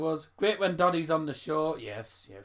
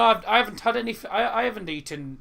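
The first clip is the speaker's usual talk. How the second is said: ordinary talk, nothing marked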